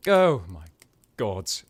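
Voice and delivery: loud "oh", falling away; loud, fed-up exclamation; exasperated outburst. Acted. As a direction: burst out in exasperation. Exasperated with captive assassin.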